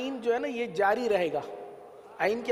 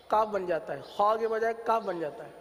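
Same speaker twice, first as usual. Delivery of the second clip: ordinary talk, nothing marked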